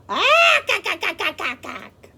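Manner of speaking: high fading kak kak cackle; bird cackle. Acted. A bird cackles at the zoo.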